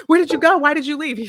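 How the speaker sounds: high, loud mock-panicked questioning; retold alarm. Wife to husband up in the night, mock alarm. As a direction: demand it urgently, pitched well up.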